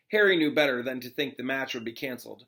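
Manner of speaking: plain reading voice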